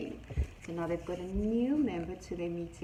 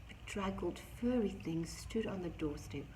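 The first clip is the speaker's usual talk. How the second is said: plain reading voice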